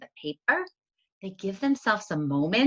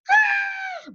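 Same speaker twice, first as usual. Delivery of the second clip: very high loud shriek; sudden high yelp; startled scream; 6 dB louder than usual. Retold or imitated. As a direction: shriek as if slipping. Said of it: "my sister said".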